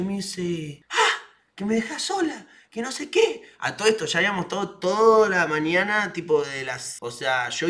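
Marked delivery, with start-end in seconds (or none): 0.9-3.4 mocking girlfriend. Girlfriend mocked, high.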